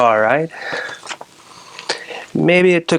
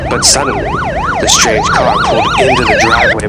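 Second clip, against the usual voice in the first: sudden shrill suspense; 4 dB louder than usual. Acted. Storyteller hitting the twist, shrill high shock. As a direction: jump way up in pitch for the shock.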